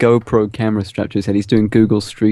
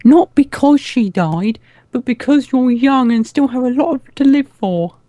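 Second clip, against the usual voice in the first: put-on high child mayor voice; 12 semitones higher, 4 dB louder, slower than usual.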